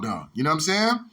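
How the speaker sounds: ordinary delivery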